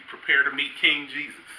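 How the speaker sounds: ordinary delivery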